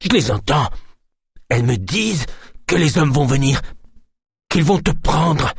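panicked seer crying out a warning; frantic outburst. Acted. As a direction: shriek the prophecy in terror. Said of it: "Alertés par son éclat de voix".

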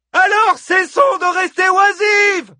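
high, slow, shouted command; bellowing. A narrator's reading. Hippodamie urges the women to act amid uproar, bellowing. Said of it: "beugla Hippodamie au sein du vacarme".

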